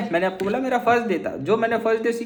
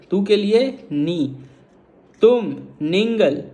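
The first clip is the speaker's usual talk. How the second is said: slow Tamil demo; slower than usual. Shown as a demonstration. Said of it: "इसको मैं तमिल में कैसे बोलता हूँ?".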